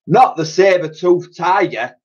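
exclaiming. A narrator's reading. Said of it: "exclaimed Dotty".

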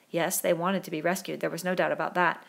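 usual speaking voice

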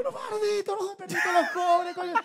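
high-pitched mock rant; gambler ranting high.

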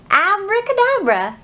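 high playful abracadabra; silly magic call. Acted. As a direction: announce the trick with glee. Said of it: "my heart is silly".

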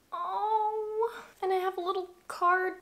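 high, tearful "aww"; deeply touched. Real, not acted. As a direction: coo in a high voice, moved almost to tears. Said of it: "I'm genuinely so touched".

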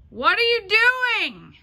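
high, loud, slow call of surprise; surprised call. Real, not acted. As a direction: call up to him, startled and drawn out. Calls out to husband up high.

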